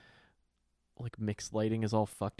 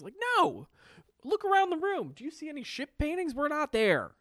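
exasperated rant; 14 semitones higher than usual. Real, not acted. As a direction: rail at the nanny in mock outrage. Ranting at the nanny.

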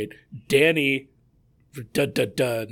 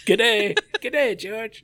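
mock Australian; 10 semitones higher, 5 dB louder, much slower than usual. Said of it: "the most Australian thing ever".

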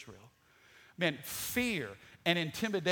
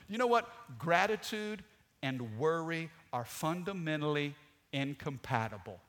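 normal talking voice

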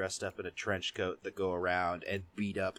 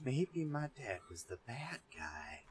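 whispered dramatic realization; breathy mock-dramatic whisper; 9 dB quieter than usual. Voicing character's realization.

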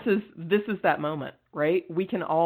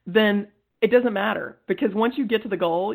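ordinary delivery

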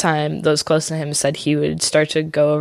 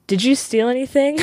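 higher, pointed question; mock suspicious Lenny; 8 semitones higher than usual. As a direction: ask it sharply, lightly imitating. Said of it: "Lenny goes".

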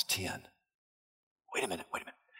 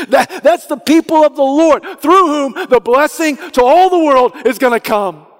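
louder, higher sermon push; fervent preaching; 9 semitones higher, 7 dB louder than usual. Acted. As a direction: drive the point home with fire. Preaching build.

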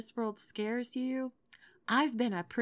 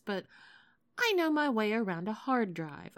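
usual speaking voice

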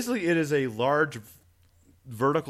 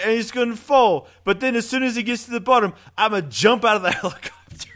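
mock British accent; 8 semitones higher, 4 dB louder than usual. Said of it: "I'm British, not Australian".

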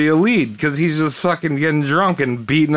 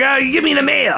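mock grumpy voice; 9 semitones higher than usual. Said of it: "He was like".

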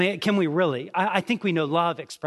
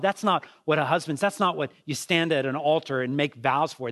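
usual speaking voice

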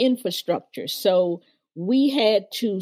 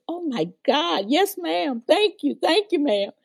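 higher, gushing "yes, ma'am" and "Thank you"; excited thanks; 8 semitones higher than usual. Retold or imitated. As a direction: thank her again and again, overjoyed. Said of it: "And I said".

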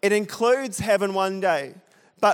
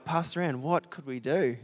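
hushed appeal; 2 semitones lower, 8 dB quieter than usual. Retold or imitated. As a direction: quietly imitate asking for help. Retells asking pastor for help.

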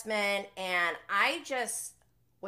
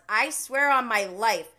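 loud, punched reading of a written reply; emphatic exclamation; 3 semitones higher, 9 dB louder than usual. Retold or imitated. Host reading out a producer's defensive reply, loud emphatic vow. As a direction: declare it forcefully, stressing each word. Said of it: "exclamation point".

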